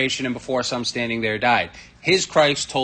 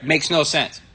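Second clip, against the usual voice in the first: normal talking voice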